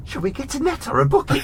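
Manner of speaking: put-on high, bright little girl with a laugh; eager child. Narrator voices the daughter eagerly asking her mum, laughing. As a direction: ask it like a keen kid.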